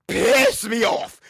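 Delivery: high, loud, drawn-out angry yell; exasperated outburst. Real, not acted. Host erupts over a narrative he hates, exasperated. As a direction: blow up, stretching the words out loud and high.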